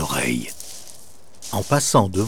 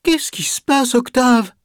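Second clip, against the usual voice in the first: higher ghost voice, anxious question; a fretting friendly ghost asking what's wrong; worried ghost; 13 semitones higher than usual. A narrator's reading. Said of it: "Le fantôme, inquiet, demande".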